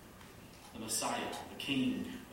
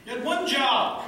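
much higher and louder, ONE hit hard; loud punchline; 13 semitones higher, 11 dB louder than usual. Real, not acted. Pastor to congregation, loud and high punchline. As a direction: belt the punchline out. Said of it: "punchline to congregation".

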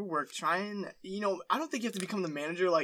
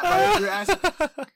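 breaking up mid-sentence; high laughing burst; 12 semitones higher, 9 dB louder, slower than usual. Real, not acted. Laughing at his own joke.

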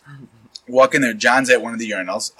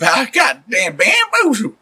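laughing; 9 semitones higher, much slower than usual. Real, not acted. Laughing at being bamboozled.

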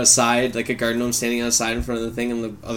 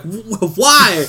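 rising to a much higher, louder why; baffled stammer; 9 semitones higher, 7 dB louder, much slower than usual. Retold or imitated. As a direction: stammer in disbelief. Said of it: "and you're like".

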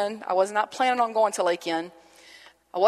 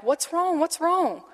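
higher-pitched, worried questioning; retold anxious concern, pitched up; 8 semitones higher, slower than usual. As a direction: imitate two alarmed onlookers asking urgently. Ladies alarmed at sobbing man.

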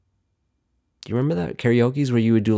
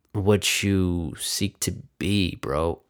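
slow, much softer, lower mock-wise voice; mock deep guru; 3 semitones lower, 6 dB quieter, much slower than usual. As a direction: hand down fake wisdom slowly. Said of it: "Ooh, that's deep".

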